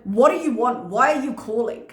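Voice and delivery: imitating a rude helpline man. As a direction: demand it brusquely, as if bothered. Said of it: "he was so rude to her".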